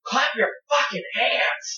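high, slow, pushed yell at the crowd; crowd-pumping yell. Shown as a demonstration. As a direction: whip the crowd up with a big yell. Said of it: "I can scream at the crowd".

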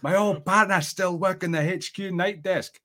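Scottish accent over a bad connection. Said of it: "like, a bad connection".

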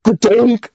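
high spoken splash effect; imitated plop. Storyteller mimics what the old guy hears, imitated plop. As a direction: punch out a comic plunking noise. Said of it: "all of a sudden you just hear".

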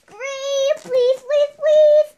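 slow, then shouted pleading; loud begging. Real, not acted. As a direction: beg at the top of your lungs. Child begs for grape popsicle.

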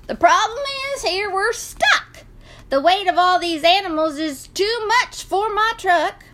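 high-pitched farmer explaining his trouble; exasperated farmer. Acted. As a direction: pitch it up in frustration. Farmer explains the breakdown to rooster.

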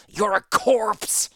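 slow, high, loud snarl; snarled threat. Acted. Wounded Torsten snarling a death threat at Havero. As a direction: spit the death threat at him. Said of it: "Torsten snarled".

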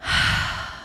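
long slow exhale to settle; deep calming breath. Real, not acted. Gathering herself before speaking from the heart, deep sigh. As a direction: breathe out to steady yourself. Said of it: "how about a deep breath".

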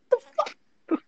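incredulous high squeak. Real, not acted. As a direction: squeak it out in disbelief. Laughing disbelief.

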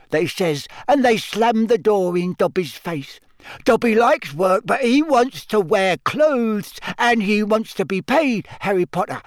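high, squeaky, emphatic elf; Dobby the house-elf. A narrator's reading. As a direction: insist proudly in a high servant's squeak. Dobby continues his story.

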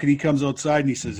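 usual speaking voice

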